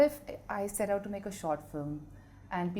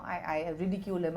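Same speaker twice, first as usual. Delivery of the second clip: ordinary delivery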